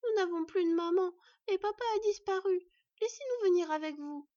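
put-on high, loud child's entreaty; high-voiced cub pleading to come along; pleading little fox girl. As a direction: beg like an orphaned little girl. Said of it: "En voyant les frimouses suppliantes".

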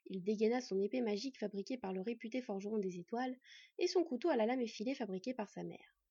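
usual speaking voice

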